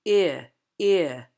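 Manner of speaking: eh broken into a two-part ee-uh glide; gliding diphthong. Shown as a demonstration. Coach showing the moving tongue, gliding diphthong. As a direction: slide the vowel from ee toward uh. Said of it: "we're gonna change that to".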